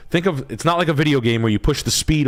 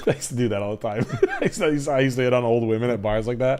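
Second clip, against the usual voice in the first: laughing; 3 dB quieter, faster than usual. Real, not acted. Cracking up after kissing demonstration.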